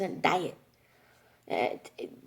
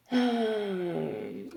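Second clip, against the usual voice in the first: long, falling sigh; exasperated sigh. Real, not acted. Daughter worn down by mom's "I don't know", exasperated sigh. Exasperated by mother's vague answers.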